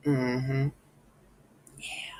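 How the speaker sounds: unbothered mm-hmms trailing off to a whispered yeah; cool, indifferent hums that show you are not bothered; calm dismissive mm-hmm. Shown as a demonstration. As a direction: brush them off with lazy, calm murmurs. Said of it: "and you just say".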